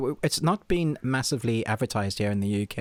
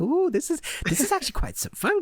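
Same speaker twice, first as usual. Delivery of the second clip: mock delight. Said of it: "and I'm like".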